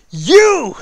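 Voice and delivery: high, loud accusing shout. Acted. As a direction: call him out sharply. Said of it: "He's like".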